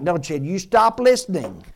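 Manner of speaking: normal talking voice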